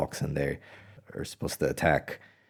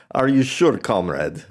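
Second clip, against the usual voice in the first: put-on higher, louder joke Russian-accent captain, doubting; a comic Russian-accent captain asking back; mock Russian captain; 5 semitones higher, 5 dB louder than usual. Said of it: "the Russians be like".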